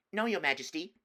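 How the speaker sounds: put-on high, hasty boy's reply; nervous boy. Edmund answering the witch, nervous. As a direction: answer quickly to appease.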